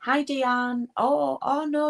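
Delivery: ordinary talk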